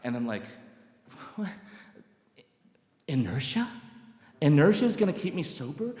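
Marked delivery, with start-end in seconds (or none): 1.3-5.9 baffled disbelief, pitch climbing into the question; incredulous. Retold or imitated. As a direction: scoff in disbelief at absurd advice. Acts out disbelief at sponsor advice.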